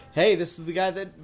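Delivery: ordinary talk, nothing marked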